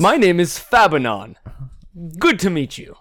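high, loud self-introduction; odd character voice. Acted. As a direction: introduce yourself as a booming oddball hero. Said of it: "He has a speech impediment".

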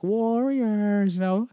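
high, loud sing-song movie taunt; Warriors taunt impression. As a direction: call it out like the film's villain, drawn out. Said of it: "like, in Warriors".

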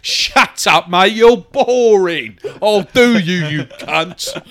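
hammed-up Cockney hard man laying into him; mock Cockney geezer rant. As James Caan berating the hungover actor, mock Cockney fury.

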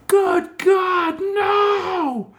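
very high, drawn-out mock scream; imitated woman screaming. Woman watching a dog attack on the street, imitated screaming. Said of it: "I hear this woman screaming".

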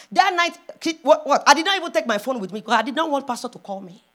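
usual speaking voice

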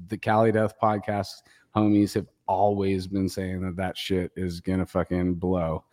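ordinary talk, nothing marked

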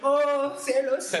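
loud, high melodramatic lament; hammy stage actor. Pretend. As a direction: wail to the heavens like a ham actor. Mock dramatic lament, wine joke.

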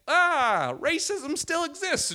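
high, slow mock stage shtick; forced joking. Comic trying material on angry crowd, forced joking. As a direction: sing out a desperate joke, hammed-up. Imitates forced joke attempt.

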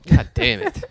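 high, strained curse; mock exasperation. Real, not acted. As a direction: squeal it in frustration at being interrupted. Joke interrupted by partner.

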